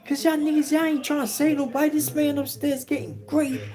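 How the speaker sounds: arguing inmate. Retold or imitated. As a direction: argue back hard to draw the guards. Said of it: "He was like".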